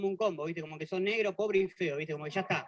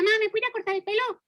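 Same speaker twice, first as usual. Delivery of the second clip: put-on much higher, small child's pleading voice; little kid asking; 12 semitones higher than usual. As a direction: ask mom like a little boy. Niño le pide a la mamá. (niño pidiéndole a la mamá con voz aguda)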